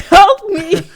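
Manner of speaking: high, loud wail; hammed-up desperate plea; mock cry for help. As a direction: wail it like a doomed victim. Imitating victim crying for help.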